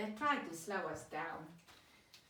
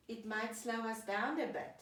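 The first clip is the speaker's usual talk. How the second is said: ordinary talk, nothing marked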